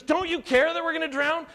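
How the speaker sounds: high, pushed reproach; accusing cry. Retold or imitated. Disciples accusing Jesus in the storm, high reproach. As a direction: fling the accusation at him. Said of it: "they said to Jesus".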